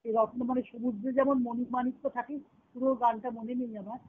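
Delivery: ordinary talk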